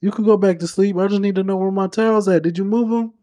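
high mock old-man voice; Papa fussing over his towels; imitating Papa. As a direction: grumble like a bossy grandpa. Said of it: "imitating Papa from the shower".

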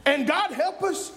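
high, driving preacher voice; impassioned pulpit delivery; fired-up preaching. Real, not acted. Preaches at full force.

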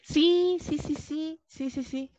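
eager yeses. Real, not acted. Eager agreement with friend.

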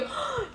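high sharp gasp of delight; mock thrilled gasp; starstruck gasp. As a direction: gasp like meeting your idol. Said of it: "y yo".

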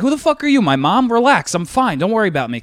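high-pitched, retold comeback; spicy retort. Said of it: "we were getting spicy".

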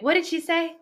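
high-pitched voicing of a curious listener perking up; startled Scout. Imagining the pet's reaction to the sunset line, high puzzled voice. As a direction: pipe up high with a puzzled question. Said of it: "Scout stood up at that point".

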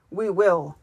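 mild, understated scowling shout; lightly voiced crooked grump. A narrator's reading. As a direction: call it out with a touch of a scowl. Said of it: "shouted the crooked knooks, scowling".

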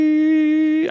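high, loud imitated beep; machine beep. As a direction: beep like a flatlining monitor. Imitating a life-support machine.

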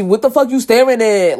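loud, high, hostile challenge; attitude. Retold or imitated. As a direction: snap it at her like a girl ready to fight. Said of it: "she had an attitude on top of that".

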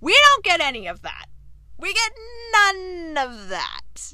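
high, loud, drawn-out exasperation; real fan outrage, stretching the last words; indignant. Real, not acted. Viewer complaining about a scene left out, indignant.

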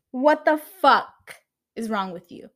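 exasperated outburst that drops off, imitated; mad at friends. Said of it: "get mad at my friends".

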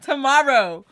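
slow and laughing, high and loud; mock his words through laughter. Real, not acted. Mocking the guy's texts while laughing to her friend.

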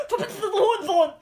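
fast, high, laughing shout; angry yell. Acted. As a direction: spit out the insult, laughing. Granny curses the dog.

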